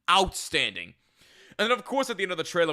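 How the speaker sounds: normal talking voice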